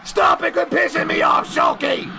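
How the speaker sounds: high, harsh shouting; angry outburst. Pretend. Heel host tells off Shulky, high angry shout. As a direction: yell at him to quit it.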